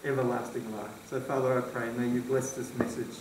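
soft, murmured prayer to the Father; hushed, reverent amen; quiet closing prayer. Real, not acted. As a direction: pray it low and hushed, as the message ends.

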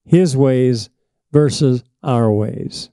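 usual speaking voice

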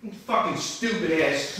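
low, soft angry grumble; muttered insult. Real, not acted. Streamer insults Samantha after struggle, low angry mutter. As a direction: curse under your breath.

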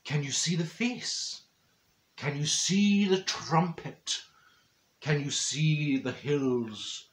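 slow, hushed, dreamy art-lover voice; arty man urging her to see hidden shapes, slow and hushed. Retold or imitated. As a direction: draw it out like a mystic gallery-goer. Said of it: "He says".

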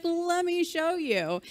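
slow, kind offer to help; slow encouraging offer to teach; helpful offer. Pretend.

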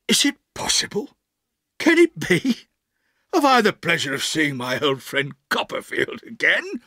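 pompous gentleman's delighted surprise; amazed Micawber. Acted. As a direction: greet a long-lost friend with grand disbelief. Micawber amazed to meet old friend.

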